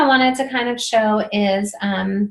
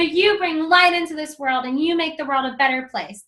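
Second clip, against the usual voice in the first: demo yell; 6 semitones higher than usual. Shown as a demonstration. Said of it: "That's usually what I yell".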